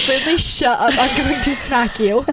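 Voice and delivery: teasing threat through laughter; laughing mock threat. Retelling snapping at Brinley in the car, laughing. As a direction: playfully threaten her while laughing. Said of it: "I was like".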